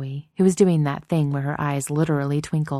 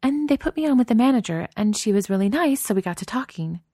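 put-on girlfriend voice; 7 semitones higher than usual. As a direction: build up to the news carefully. Narrator voices nervous Chloe.

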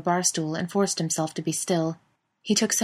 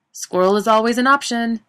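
higher, louder teasing call; 6 semitones higher, 8 dB louder than usual. A narrator's reading. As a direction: call it out cheekily. Chris teasing shout.

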